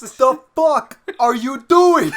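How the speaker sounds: hammed-up furious shout, high and drawn out; very loud, high mock tirade breaking into laughter. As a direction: bellow it slowly in mock outrage. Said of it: "He'd be yelling at his publicist".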